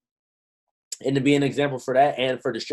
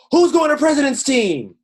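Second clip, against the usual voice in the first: mock event host calling out; 13 semitones higher, 9 dB louder than usual. Mocking a distracted event-goer.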